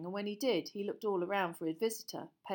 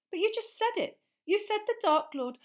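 insistent protest; 12 semitones higher, 7 dB louder than usual. Acted. Harry protests.

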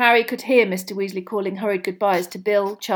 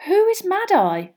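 put-on young Harry asking; 11 semitones higher, 6 dB louder than usual. A narrator's reading. Harry voiced.

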